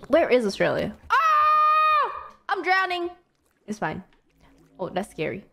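ordinary talk

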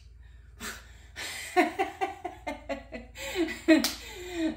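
a loud, high burst of laughter; hearty laugh. Real, not acted. Laughs at own mistake.

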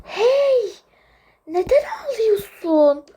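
ordinary talk

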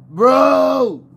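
high, loud stretched yell; exasperated shout. Real, not acted. Player yelling at his bro, high loud shout. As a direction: holler it to get his attention. Calling teammate, escalating.

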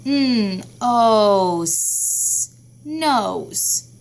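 teacher modelling sounds of nose; sounding out. Shown as a demonstration. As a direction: sound out nose as N, O, S, then blend nose. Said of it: "Do the sounds of nose with me".

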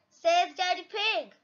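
ordinary delivery

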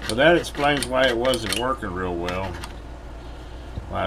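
usual speaking voice

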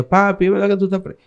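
put-on high, quick child's voice; little daughter's voice. Three-year-old daughter asking her papi if he's jailed, high quick child voice. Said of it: "estaba la niña".

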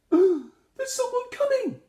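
very high, loud, slow panicked shout; alarmed little Monkey yelling the warning. A narrator's reading. Monkey warns the village of a stranger, shouting high. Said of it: "shouted Monkey".

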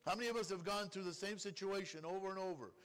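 ordinary delivery

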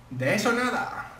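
high, slow, scoffing refusal; mock indignant. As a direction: brush him off with a huff. Rival villager refuses. (negativa burlona y aguda)

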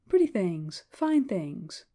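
ordinary delivery, nothing marked

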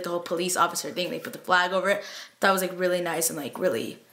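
usual speaking voice

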